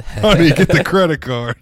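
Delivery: high, loud eager buyer; excited call to wife. Pretend. Imitates eager buyer calling wife.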